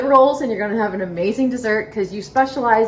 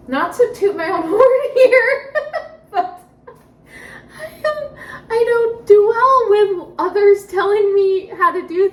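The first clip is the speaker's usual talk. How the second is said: laughing through tears; 9 semitones higher, 5 dB louder than usual. Real, not acted.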